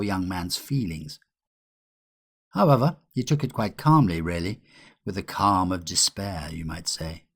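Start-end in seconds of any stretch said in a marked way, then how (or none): none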